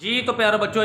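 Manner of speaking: ordinary talk, nothing marked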